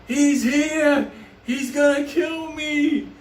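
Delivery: high, stretched-out mock cry for help; retold screaming. Trapped friend screams out the window to his crew, mock panicked cry. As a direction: imitate a friend yelling from a window, slowing at the end. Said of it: "B D screaming out the window".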